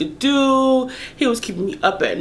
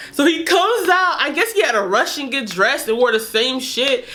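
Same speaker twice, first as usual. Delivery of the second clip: ordinary talk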